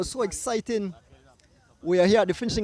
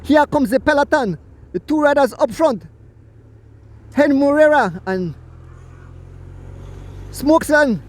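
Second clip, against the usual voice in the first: quick, raised play-by-play call; race commentary; 6 semitones higher, 8 dB louder than usual. Acted. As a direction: call the finish of the bike race live.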